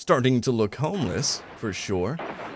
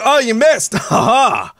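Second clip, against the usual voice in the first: high gloating jeer; mock them with a laugh; taunting laugh; 10 semitones higher, 7 dB louder, slower than usual. Real, not acted. Taunting after enemy misses.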